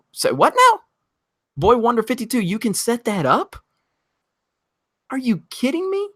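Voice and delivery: can't believe the offer; thrilled disbelief. Real, not acted. Thanking a viewer who offers a screening, high and thrilled. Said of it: "excited by donor offer".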